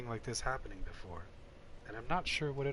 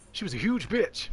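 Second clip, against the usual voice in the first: higher, louder cheeky blurt; mock outburst; 9 semitones higher, 8 dB louder than usual. Player jokes about Shiva, louder and higher.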